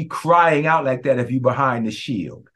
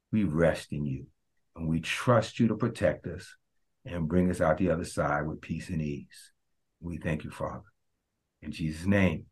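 soft, low, hushed prayer; 8 semitones lower, 8 dB quieter than usual. Acted. Pastor praying softly over the listeners. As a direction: pray it gently over them.

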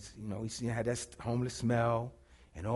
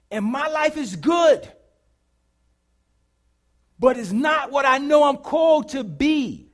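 higher, louder preaching cry; 8 semitones higher, 10 dB louder than usual. Acted. Preacher urging the church to move, full force. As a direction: lift it to the congregation.

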